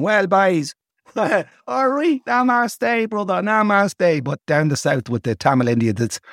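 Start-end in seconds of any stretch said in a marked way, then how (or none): 0.0-4.2 high-pitched mock Dublin lads greeting. Imitating lads going around Dublin, high mock greeting. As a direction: put on high, drawn-out lads hailing each other. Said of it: "lads going around Dublin going".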